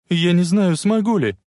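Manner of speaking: anxious cry. A narrator's reading. Frightened woman on burning locomotive.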